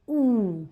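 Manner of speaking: ऊ drawn out like a teacher; slow vowel demo. Shown as a demonstration. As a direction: hold ऊ long and round. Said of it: "इसका उच्चारण होता है ऊ".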